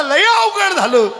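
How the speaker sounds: high, slow groaning complaint. Retold or imitated. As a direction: moan in pain.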